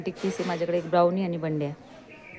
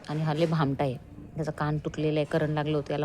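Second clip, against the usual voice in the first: normal talking voice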